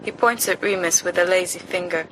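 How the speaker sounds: plain reading voice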